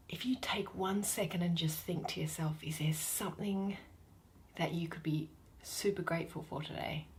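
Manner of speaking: hushed, breathy aside to listeners; soft whisper. Real, not acted. Host inviting listeners to pause, in a soft whisper.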